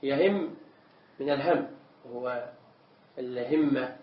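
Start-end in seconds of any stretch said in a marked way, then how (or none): 0.0-0.5 "يهم" said yahimm, with a on the ya; open ya. Shown as a demonstration. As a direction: start "يهم" with a short a, yahimm. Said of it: "بفتح الياء من هم".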